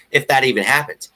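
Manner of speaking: normal talking voice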